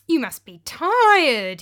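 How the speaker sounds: drawn-out, fake-friendly welcome; greasy innkeeper. Greasy taverna owner's offer.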